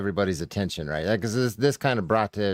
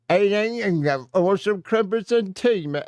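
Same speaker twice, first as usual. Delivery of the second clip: a high, hammed-up British accent, a bit louder; mock British accent; 11 semitones higher, 3 dB louder than usual. Streamer mocking a British accent he hates. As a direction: send up a posh Brit asking for tea.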